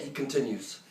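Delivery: ordinary talk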